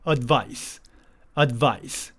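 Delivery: advice with the d swallowed and pressed, gliding straight into vice; 올바른 발음: advice, D를 누르듯; correct pronunciation. Shown as a demonstration. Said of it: "할 수 있겠어요?".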